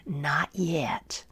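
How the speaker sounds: soft whispered refusal; quiet whisper. Acted. Master quietly refusing the dizzy clay, whispered. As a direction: hush it, calm and firm. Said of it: "said quietly".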